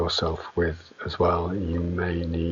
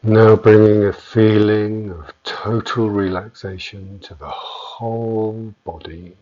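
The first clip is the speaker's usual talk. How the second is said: guided meditation; 6 dB louder than usual. Acted. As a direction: guide them into stillness with long pauses between phrases. Leads a yoga nidra meditation.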